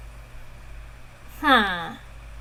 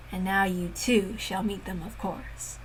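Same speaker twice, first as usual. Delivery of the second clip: fading into a sinister whisper; menacing whisper; 4 semitones lower, 11 dB quieter than usual. Acted. Villain promising her death, menacing whisper. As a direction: breathe the threat.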